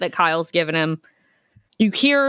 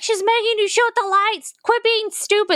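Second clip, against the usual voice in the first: high-pitched imitation of frantic screaming; strained, very high mock scream; retold screaming; 12 semitones higher than usual. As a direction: yelp it way up high, mock panic. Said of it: "Kyle's in his little cage screaming".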